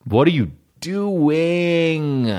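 high, slow, drawn-out disbelief; incredulous. Real, not acted. Mocking men who contour their abs, in high, slow disbelief. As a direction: stretch it out in disbelief.

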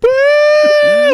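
very high loud shouted whoop; a loud mock battle cry; whooping woo. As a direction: whoop like a gleeful shooter.

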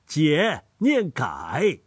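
解 said as gai, like 改, slow and deliberate; Hubei dialect pronunciation. Shown as a demonstration. Grandfather shows Hubei reading of 解 as gai. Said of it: "我们湖北方言当中啊".

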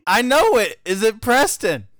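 high, loud blurted protest and guess; loud insistence. Real, not acted. Pressed on a friend's middle name, loudly insistent. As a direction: raise the voice, defensive.

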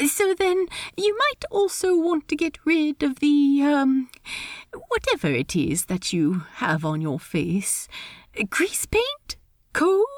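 high, lilting, polite voice; awkward host in a high register; Steed, politely awkward. Acted. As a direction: pitch it high, hesitate politely. Steed hints delicately.

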